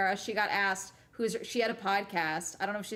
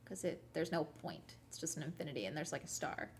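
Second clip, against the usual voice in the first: much softer, trailing near-whisper; quiet aside; 4 semitones lower, 12 dB quieter, faster than usual. Real, not acted. Explaining the heart sign to viewers very softly. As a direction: drop almost to a whisper.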